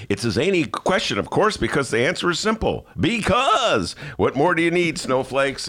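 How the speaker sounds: high, mocking sarcastic read; sarcasm. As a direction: sneer it like a smug columnist. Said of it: "it's like sarcasm".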